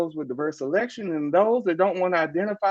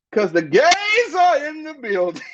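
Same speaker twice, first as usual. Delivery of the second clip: high, slow, louder with a laugh; excited announcement; 11 semitones higher, 4 dB louder than usual. Acted. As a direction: hype it up like a showgirl intro. Host hyping up the arrival.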